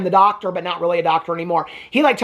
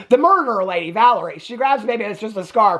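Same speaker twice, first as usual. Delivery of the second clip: usual speaking voice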